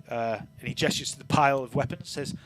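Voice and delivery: ordinary talk, nothing marked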